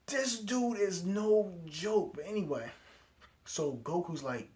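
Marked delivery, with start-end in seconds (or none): 0.1-2.1 high, loud, drawn-out exclamation; big hyped-up disbelief, stretched out; emphatic amazement. Real, not acted. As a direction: cry it out slowly, stressing every word. Exclaiming over the killings.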